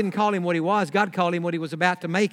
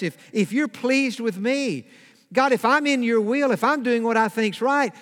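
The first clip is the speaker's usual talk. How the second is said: higher-pitched, insistent plea, stumbling on the ifs; pleading with God; 4 semitones higher than usual. Acted. Believer questioning God over hardship, pleading. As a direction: plead your case with mounting bewilderment.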